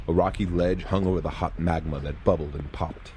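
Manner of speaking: ordinary delivery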